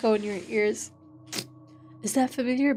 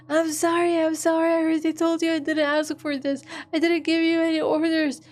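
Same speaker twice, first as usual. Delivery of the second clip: desperate crying plea; 5 semitones higher than usual. Acted. Desperate girl pleads, crying.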